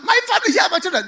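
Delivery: loud, high begging; pleading crowd. Acted. Mockers beg Noah in rain.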